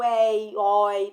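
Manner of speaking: "ei, oi" com vogal bem aberta, éi e ói, bem alto; ditongo aberto. Shown as a demonstration. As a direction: alongue e abra "ei, oi" como éi, ói. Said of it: "Não é aberto?". ("ei, oi" forte, com é e ó abertos)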